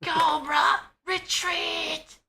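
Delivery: high, far-off screeching shout; Cobra Commander voice. Retold or imitated. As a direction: screech the retreat like a cartoon villain. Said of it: "that's what he says".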